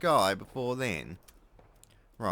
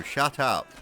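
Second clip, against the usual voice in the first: ordinary talk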